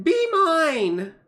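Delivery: high, loud, drawn-out plea; intense, over-the-top infatuated cry; possessive lover's cry. Acted. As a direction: claim them with a big mock-romantic shout.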